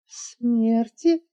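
hoarse gasp. A narrator's reading. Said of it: "прохрипела".

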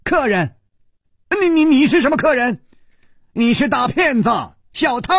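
high, fast frantic yell. Acted. Madman accusing Chu Liuxiang, high frantic yell. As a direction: sputter it out high and fast, stammering. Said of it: "大叫着说".

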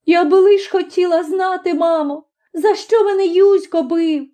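high tearful plea to mother; whimpering, high-pitched protest; sobbing. A narrator's reading. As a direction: plead through tears, voice high. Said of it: "хлипала вона".

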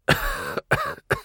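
acted cough; coughing fit. A narrator's reading. Supply man breaking off mid-protest, coughing. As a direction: cough as if choking on wind. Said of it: "закашлялся".

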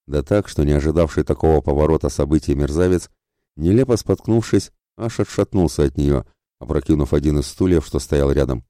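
ordinary delivery, nothing marked

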